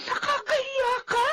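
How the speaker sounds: high, breaking cry of shame; mother ashamed of her daughter; tearful scolding. Acted. (umiiyak na panunumbat)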